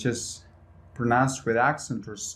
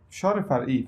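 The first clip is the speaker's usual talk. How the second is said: ordinary talk, nothing marked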